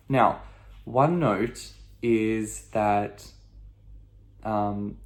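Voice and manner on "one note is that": usual speaking voice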